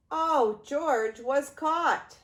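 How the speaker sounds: plain reading voice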